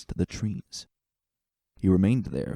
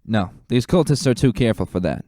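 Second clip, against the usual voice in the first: loud, higher barked orders to the squad; rally the troops with a firm officer's call; commanding lieutenant; 3 semitones higher, 5 dB louder than usual. Acted. Lieutenant ordering stallions to join the forest search, loud and commanding.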